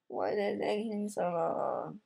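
high, soft, drawn-out whine; mock whine. Player tired of waiting for the Nether, mock whining. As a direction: complain in a small pleading squeal. Complains about slow loading.

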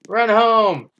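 loud, high urgent call; loud high cry to flee; urgent shout. Real, not acted. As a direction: yell at them to run. Urging escape.

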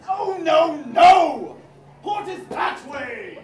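loud exasperated yelling; angry shout. Acted. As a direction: bellow at the confused crew. Captain scolding wrong direction.

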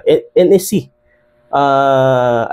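normal talking voice

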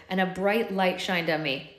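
ordinary delivery

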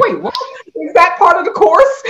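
high, loud, crying-laughing disbelief; laughing through tears. Retold or imitated. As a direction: retell the shock while half sobbing, half laughing. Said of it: "I was crying and I was like".

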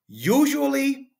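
exaggerated high, slow usually; mock-drawn usually. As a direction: sing it up high and slow, teasing.

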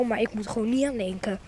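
ordinary talk

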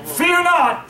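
usual speaking voice

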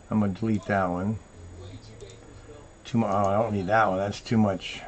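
soft talking to himself; quiet muttering. Real, not acted. Host sorting photographs to himself, quiet muttering. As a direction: mutter under your breath.

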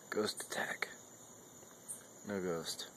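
very soft, unvoiced whisper; spooky whisper. Owner teasing his dog about a ghost, whispered. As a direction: whisper it as a playful scare.

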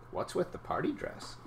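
softly, with rising surprise; surprised. A narrator's reading. Dad asks about the party dress, mildly surprised. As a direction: ask it with poorly hidden surprise, voice lifted. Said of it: "failing to hide his surprise".

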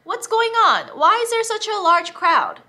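high, loud call to the crowd. Acted. Blind beggar calls out to the passing crowd, loud and high. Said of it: "he called out".

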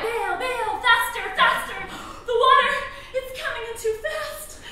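high, urgent cries to hurry; frantic urging. Pretend. Urging husband to bail the sinking boat, frantic. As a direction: drive them on in acted panic.

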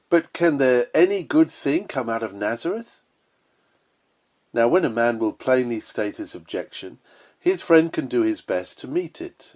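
ordinary talk, nothing marked

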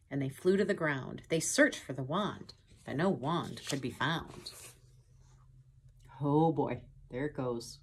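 ordinary delivery, nothing marked

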